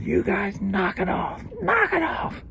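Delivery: high, thin-volume mimicked scolding; drawn-out, high-pitched mock telling-off; voice from above. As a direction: imitate someone calling down to break up a fight. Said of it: "this voice goes".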